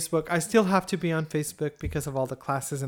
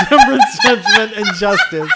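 much higher, louder, laughing; laughing hysterically; 5 semitones higher, 12 dB louder than usual. Real, not acted. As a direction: squeal the list out laughing. Laughing list of cards.

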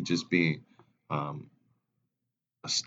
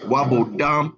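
father voice; 8 semitones higher, 9 dB louder than usual. Retold or imitated. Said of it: "He said".

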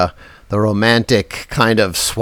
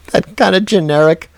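ordinary delivery

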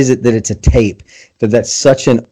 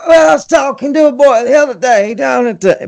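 put-on high old southern storyteller voice, much higher; Will Willimon imitation; 12 semitones higher than usual. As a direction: do the old southern storyteller with a Winnie the Pooh sound. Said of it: "Your imitation was endearing".

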